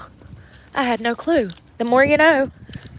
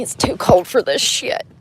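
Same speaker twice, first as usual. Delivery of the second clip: ordinary talk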